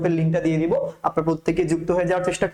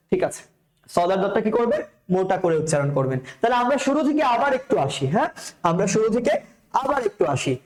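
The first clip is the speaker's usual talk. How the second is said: ordinary delivery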